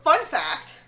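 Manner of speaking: normal talking voice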